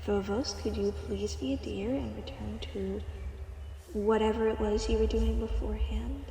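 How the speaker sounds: usual speaking voice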